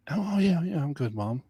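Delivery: high, light brush-off to mom; retold reassurance. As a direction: imitate assuring a worried mother you're fine. Retold answer brushing off mom's concern.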